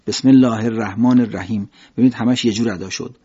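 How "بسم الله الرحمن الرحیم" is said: ordinary talk, nothing marked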